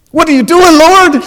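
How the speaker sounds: very high, loud comic wail to God; mock-desperate prayer. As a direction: cry out to the Lord in exaggerated dismay. Comic alarmed prayer on seeing ex-pastor.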